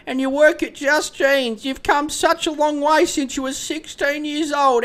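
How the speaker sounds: high, loud mock forced praise; dad to daughter, hammed-up forced pride; fake-proud dad. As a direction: praise her through a fake smile. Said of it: "wearing the fakest fucking smile".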